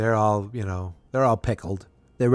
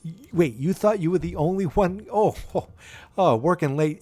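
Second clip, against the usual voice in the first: high-pitched imitation of incredulous teasing; mock disbelief; 8 semitones higher than usual. Carla to Sam on being the only one, mock disbelief. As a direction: play up the realization, pitched way up.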